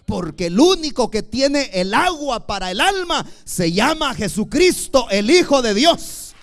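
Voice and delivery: loud, ringing preacher proclamation; full-force preaching. Acted. Pastor to the brothers, preaching at full force.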